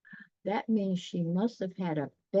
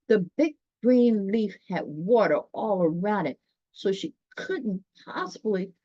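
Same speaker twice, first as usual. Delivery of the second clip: storybook narration; 3 semitones higher, 4 dB louder than usual. A narrator's reading. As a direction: read it like a bedtime picture book. Reads storybook narration aloud.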